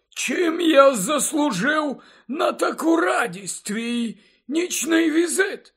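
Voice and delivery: very high, slow character voice; surprised kindly headmaster. A narrator's reading. Dumbledore asking about Harry's night visit, pleasantly surprised. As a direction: greet a late visitor in a high, drawn-out voice.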